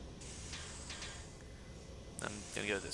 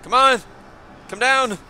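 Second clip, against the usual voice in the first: pleading shout; 9 semitones higher, much slower than usual. Real, not acted. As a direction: yell for a way out. Calling out, stuck in the game.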